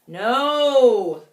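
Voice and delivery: loud no; the crowd yelling no; yelled. Acted. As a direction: answer all together. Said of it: "the crowd yelled".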